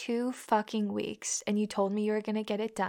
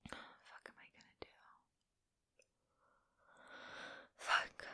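whispered despair; 20 dB quieter than usual. Acted. Despairing curse to herself.

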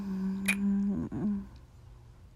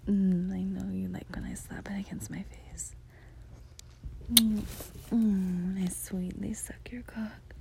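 whispering; 4 dB quieter than usual. Acted. Whispers throughout, intimate.

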